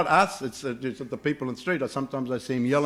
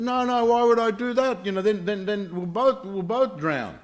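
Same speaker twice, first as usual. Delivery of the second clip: high, emphatic mock protest; 8 semitones higher, 4 dB louder than usual. Scorpion reassuring a wary camel, protesting high. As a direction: protest innocence in a raised pitch.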